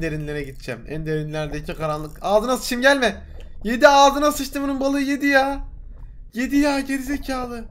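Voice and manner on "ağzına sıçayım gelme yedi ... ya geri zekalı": higher, frustrated complaint; annoyed outburst. Real, not acted. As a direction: vent at the fish. Fish gets eaten, annoyed. (sinirli yakınma)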